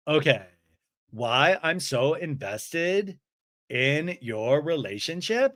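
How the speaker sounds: slow, smiling echo; Lisa. Retold or imitated. Smiling friend repeats the complaint back, slowly and incredulously. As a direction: draw it out as a deliberate question. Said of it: "And Lisa's smiling".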